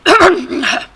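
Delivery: loud high donkey bray cough; braying throat clear. Acted. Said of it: "cleared her throat with a bray".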